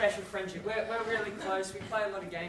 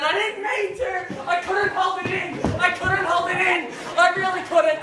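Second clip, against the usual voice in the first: hammed-up pleading yell, higher and louder; desperate plea; 8 semitones higher, 10 dB louder than usual. Little brother pleading with mum, mock desperate yell. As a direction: beg forgiveness like a panicked kid. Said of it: "my brother said".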